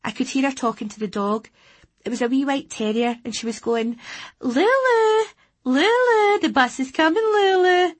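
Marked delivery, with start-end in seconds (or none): 4.4-7.9 put-on squeaky high baby talk to a dog. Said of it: "to it in a high baby voice".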